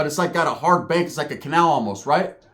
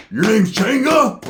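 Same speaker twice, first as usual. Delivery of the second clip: drawn-out, pitched up, louder; slow high loud Roach; 6 semitones higher, 5 dB louder, slower than usual. Retold or imitated. Roach questioning Pepe, slow high and loud. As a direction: stretch it out like a spun-out guy. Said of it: "Roach was in that motherfucker like".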